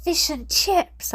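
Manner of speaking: slow, high delighted cry; child yelling fish and chips in delight; excited yell. A narrator's reading. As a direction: cheer it out like a prize. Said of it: "I yelled".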